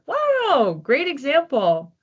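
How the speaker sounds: a high, bright burst of genuine surprise; delighted surprise. Real, not acted. Host reacts to a caller's toy story with surprise. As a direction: react like a friend just handed you the perfect example. Listener mentions a nostalgic toy.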